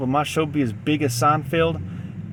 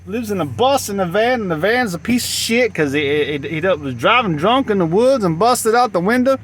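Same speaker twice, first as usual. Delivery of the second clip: higher, louder mock spirit voice; voice of the dead; 7 semitones higher, 5 dB louder than usual. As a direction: heckle loudly as the dead, pitched up. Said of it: "The dead people saying".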